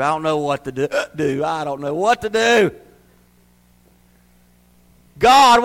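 usual speaking voice

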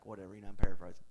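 ordinary talk, nothing marked

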